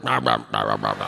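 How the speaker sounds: very low, guttural "rah rah" gobbling noises; mock ravenous gobbling. Men answering a woman's invitation, mock ravenous gobbling. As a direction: gobble greedily like a hungry animal. Acts out men eagerly eating.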